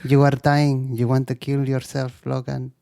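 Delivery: imitating a little girl's Spanish accent; Laura. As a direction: plead in a mock Spanish accent. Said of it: "what happened to Rebecca, Delilah?".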